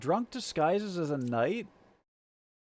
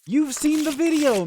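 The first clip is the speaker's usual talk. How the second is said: high, loud excited urging; 11 semitones higher, 8 dB louder than usual. Real, not acted. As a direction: push it with excitement. Excited urging about video.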